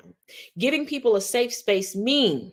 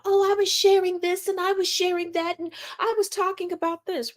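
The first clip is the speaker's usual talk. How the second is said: high mock whine of her excuse; 10 semitones higher than usual. Commentator parroting the woman's excuse, high sarcastic mimicry.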